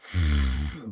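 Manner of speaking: loud, low exasperated sigh; a low, loud sigh of anger; heavy sigh. Acted. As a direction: blow out the frustration before the outburst. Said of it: "This whole thing has me on edge".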